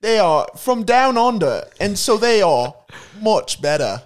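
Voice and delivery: broad Aussie pitchman; hammed-up Australian commercial voice; Australian accent. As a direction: put on an Aussie accent for a mock ad.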